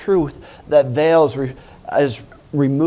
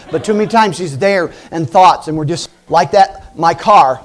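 ordinary talk, nothing marked

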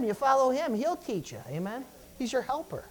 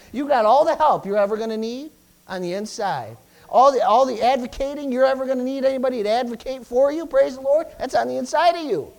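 louder, higher, full-throated preaching climax; preaching at full force; 5 semitones higher, 8 dB louder than usual. Acted. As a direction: drive it home like a revival preacher. Preaching climax, building loud.

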